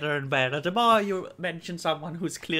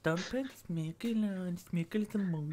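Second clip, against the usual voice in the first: very soft put-on voice; odd hushed voice; 11 dB quieter than usual. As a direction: murmur it oddly under your breath. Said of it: "why are you talking like that".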